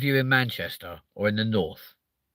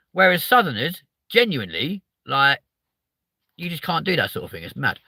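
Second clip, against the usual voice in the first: ordinary talk, nothing marked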